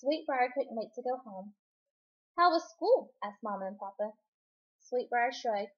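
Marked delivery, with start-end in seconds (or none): 2.4-3.1 cheerful greeting. A narrator's reading. Parents greet her.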